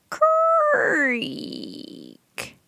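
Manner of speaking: high drawn creak; creaking noise. A narrator's reading. Narrator voices the floor creaking underfoot.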